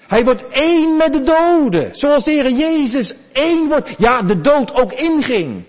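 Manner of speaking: high, loud, rising sermon climax; preacher lifting the whole room, loud and high; preaching at full force. Acted. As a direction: drive the message home to the congregation.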